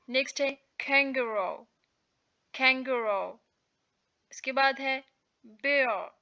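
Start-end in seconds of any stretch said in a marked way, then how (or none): none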